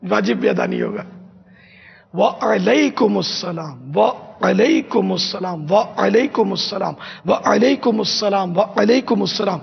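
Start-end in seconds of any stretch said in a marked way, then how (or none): 2.1-9.6 slow وعلیکم السلام with the ain of وعلیکم clear; patient teacher's model greeting; drilled pronunciation. Shown as a demonstration. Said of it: "یہ رٹنا پڑے گا".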